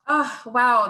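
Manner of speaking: usual speaking voice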